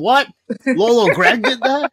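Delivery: high, loud astonished squeal of disbelief; can't believe grandpa did that; amazed disbelief. Retold or imitated. Asking mom about grandpa in loud, high disbelief. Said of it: "And I was just like".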